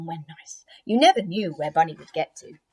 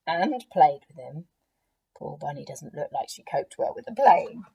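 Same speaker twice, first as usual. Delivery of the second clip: ordinary delivery, nothing marked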